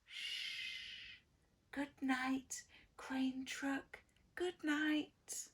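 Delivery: soft, gentle shushing good night; hushed. A narrator's reading. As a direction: hush as if a child sleeps. Hushed bedtime refrain.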